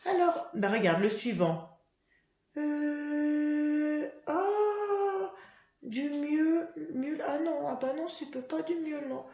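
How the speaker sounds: put-on high, childlike puppet voice sounding out words; Mimi puppet voice. Teacher voicing Mimi the puppet for pupils, high childlike voice.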